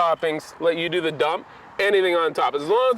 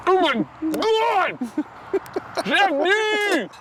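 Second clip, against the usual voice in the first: high delighted exclamation; excited delight; 10 semitones higher, much slower than usual. Real, not acted. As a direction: rave about the first bite. First bite of the food.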